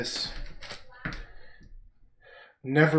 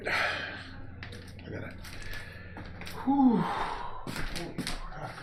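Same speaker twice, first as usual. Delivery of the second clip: exhausted sigh; 8 semitones higher, 7 dB quieter, much slower than usual. Real, not acted. Overwhelmed by head noise.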